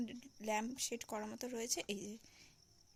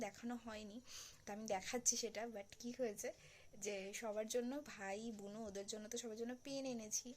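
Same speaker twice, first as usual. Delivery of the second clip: ordinary talk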